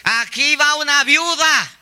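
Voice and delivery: high, slow, drawn-out announcement; announcing widow's dress. Acted. Preacher voicing what a widow's dress tells the street, high slow proclamation. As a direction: proclaim it slowly in a high put-on voice. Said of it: "¿el vestido qué dice?".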